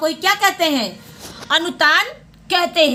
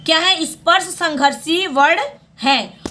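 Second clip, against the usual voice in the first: usual speaking voice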